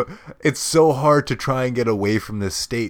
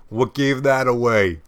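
ordinary talk

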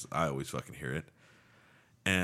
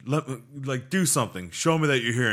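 loud, high-pitched challenge to a ghost; goading, raised voice; taunting; 7 semitones higher, 6 dB louder than usual. Retold or imitated. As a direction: taunt it like you dare it to act. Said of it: "starting to taunt the ghost".

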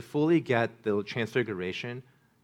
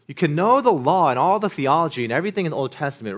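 louder, higher rising sermon climax; full-force preaching; 4 semitones higher, 6 dB louder than usual. Acted. Pastor urges the congregation that only Jesus comforts, preaching at full force. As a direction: drive the point home to the congregation.

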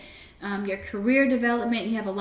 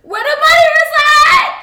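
loud high punchline; 15 dB louder than usual. Pretend. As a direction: belt the punchline out, much higher and louder. Punchline to rhyme.

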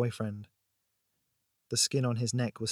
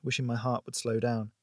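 ordinary delivery, nothing marked

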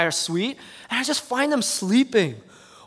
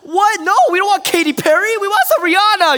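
much higher and louder, indignant; frustrated outburst; 12 semitones higher, 7 dB louder than usual. Retold or imitated. Yelling at the DJ about the music, indignant shout. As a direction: yell over the wrong song. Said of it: "And I'm like".

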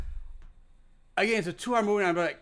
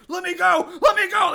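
higher and louder, mock frantic cry; imitated yelling; 10 semitones higher, 4 dB louder than usual. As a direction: ham up a desperate yell. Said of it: "when he's like".

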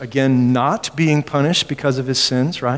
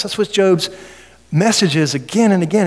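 usual speaking voice

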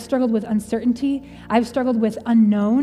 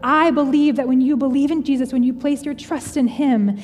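impassioned preaching; 4 semitones higher than usual. Real, not acted. Speaker urging listeners to trust Jesus, impassioned preaching. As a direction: build it up like a pastor at full force.

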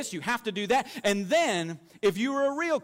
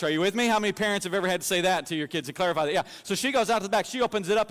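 ordinary delivery

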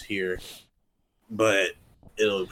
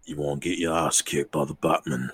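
fake British accent; 2 semitones lower, 4 dB louder than usual. British Batman threatens the Riddler, put-on British accent. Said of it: "fake accent that you're doing".

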